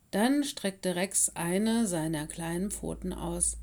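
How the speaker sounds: usual speaking voice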